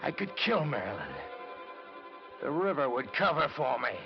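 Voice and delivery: tense monologue as a dark idea takes hold; tense realization. Acted. Narrator seized by murderous idea.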